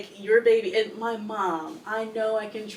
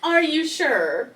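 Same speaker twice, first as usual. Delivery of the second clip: higher, slow mock crying question; mock whine; 7 semitones higher, 5 dB louder, slower than usual. Mocking mom's claim.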